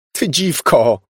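furious yell; angry shout. A narrator's reading. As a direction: cry out in outrage. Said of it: "krzyknęła Elizabeth".

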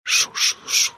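unvoiced shh-shh sounds; whispered shushing. A narrator's reading. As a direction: breathe the shushes like a ghost's whisper. Whispering imitated in narration.

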